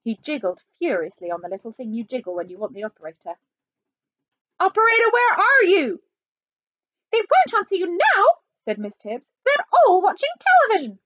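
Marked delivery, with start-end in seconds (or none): 4.6-6.0 high, loud call; calling out impatiently. Acted. President calls for the operator. 7.1-9.3 high, loud nanny voice; piercingly high character voice. Acted. Miss Tibbs answers in character. 9.4-11.0 high, loud, quick nanny voice; high-pitched, brisk character voice. Acted. Miss Tibbs answers in character.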